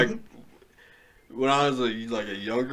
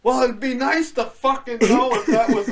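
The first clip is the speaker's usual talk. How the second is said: laughing mock parent swear; 12 semitones higher, 5 dB louder than usual. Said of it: "They'd be like".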